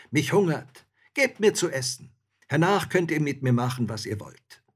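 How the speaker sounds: loud, high demand; cheeky soldier demanding his meal; bold soldier. A narrator's reading.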